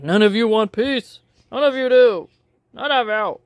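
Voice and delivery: hammed-up furious lecture at billions of people; mock angry rant. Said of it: "is like".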